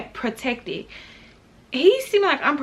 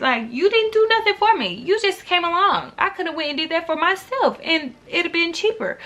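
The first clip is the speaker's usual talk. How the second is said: indignant; 6 semitones higher than usual. Real, not acted. Indignant rant at date.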